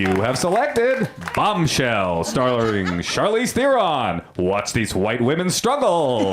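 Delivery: slow, swooping automated-line announcer; Movie Phone guy. Acted. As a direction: stretch the titles with huge rises and falls. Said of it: "do a pretty good movie phone guy".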